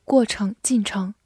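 usual speaking voice

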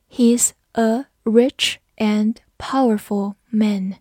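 slow, word by word with pauses; slow read-along. Shown as a demonstration. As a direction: model the sentence slowly for learners. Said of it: "跟着我慢读一遍". (慢速逐词领读)

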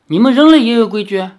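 startled demon king; surprised. Acted. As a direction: blurt it out in astonishment. Demon surprised at humans having rules.

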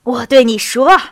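loud, emphatic exclamation; calling out. A narrator's reading. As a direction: cry it out insistently. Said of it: "杰尼索夫喊道".

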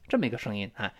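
normal talking voice

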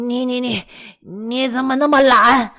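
put-on drunk father yelling at his son; angry scolding. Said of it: "一边打骂贝多芬". (醉醺醺的父亲怒骂)

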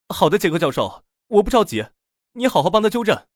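loud, high-pitched agreeable shout; calling back. A narrator's reading. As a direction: answer loudly through a closed door. Man outside calls back through door.

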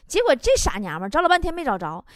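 usual speaking voice

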